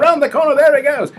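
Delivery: very high, loud Australian track announcer; Aussie race caller. Retold or imitated. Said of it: "I did an, Au-, an Aussie voice".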